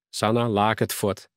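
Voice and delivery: usual speaking voice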